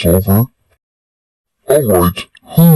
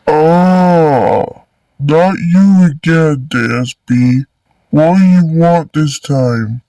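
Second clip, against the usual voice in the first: higher, weary annoyance; exasperated; 4 semitones higher than usual. Acted. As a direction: groan it out, fed up. Principal exasperated at student.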